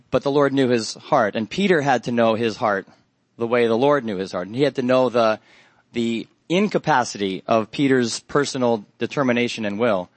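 ordinary talk

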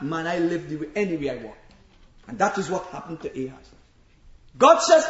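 ordinary talk, nothing marked

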